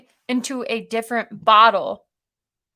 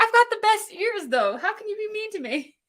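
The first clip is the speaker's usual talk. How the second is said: voicing the dog; 9 semitones higher than usual. Voicing the dog's reply.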